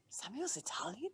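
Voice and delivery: whispered disbelief; stunned hushed question; shocked whisper. Retold or imitated. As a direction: hiss it in secret surprise. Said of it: "and I was like".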